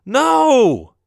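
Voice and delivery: loud startled cry from a nightmare. Acted. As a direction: wake up crying out no. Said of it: "I cried out while waking up".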